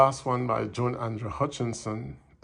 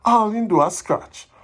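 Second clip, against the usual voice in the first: higher, louder than chat, indignant complaint; dramatic poem recital; 6 semitones higher, 7 dB louder than usual. Acted. Neighbour complaining about the mangy mongrel dog, animated recital. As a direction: perform it as animated Jamaican Patois verse.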